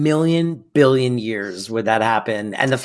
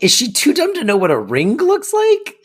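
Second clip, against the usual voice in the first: higher, lightly mocking disbelief; mock incredulous; 9 semitones higher than usual. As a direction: play the baffled viewer, half-joking. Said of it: "a line where you're like".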